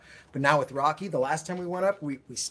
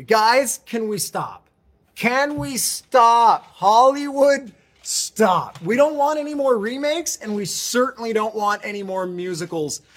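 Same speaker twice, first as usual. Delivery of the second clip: exasperated rant; 3 semitones higher, 6 dB louder, slower than usual. Real, not acted. Mock pleading rant at Hollywood.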